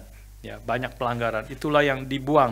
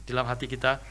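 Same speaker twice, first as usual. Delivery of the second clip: ordinary delivery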